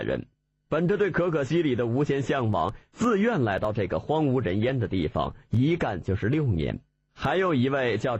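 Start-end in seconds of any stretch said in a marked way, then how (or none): none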